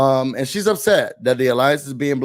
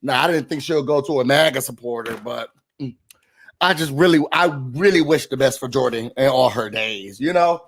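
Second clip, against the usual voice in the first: normal talking voice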